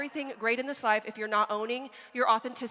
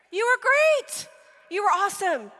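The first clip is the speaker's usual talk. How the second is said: higher, louder, gushing parent praise; 7 semitones higher, 5 dB louder than usual. Retold or imitated. Parent gushing at kid after the game, higher and louder. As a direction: pour on the praise like a proud parent.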